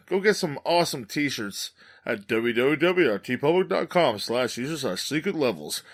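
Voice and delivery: normal talking voice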